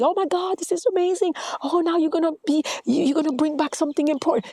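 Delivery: high, fast, gushing delight; excited people. Retold or imitated. Villagers greeting her with fast, high delight. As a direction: gush like thrilled villagers.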